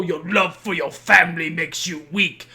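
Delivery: a hint of a mock movie villain; mock villain delivery. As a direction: sneer it like a cartoon villain. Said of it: "look someone in the fucking eyes and go".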